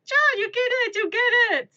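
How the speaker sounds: high, loud excited shouting; mock German yelling.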